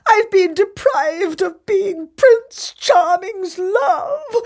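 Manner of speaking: high, hammed-up wailing and sobbing; overacted weeping, half laughing; wailing sobs. A narrator's reading. Daughter sobbing into her mother's arms, hammed-up wail. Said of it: "She cried and wept".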